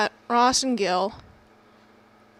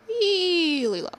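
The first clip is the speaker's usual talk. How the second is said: really low; 2 semitones higher, 4 dB quieter, slower than usual. Shown as a demonstration. Announcing Ross's voice for the play, sliding down low. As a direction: stretch it out, sliding downward. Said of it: "Ross is gonna talk".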